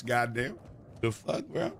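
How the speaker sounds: ordinary delivery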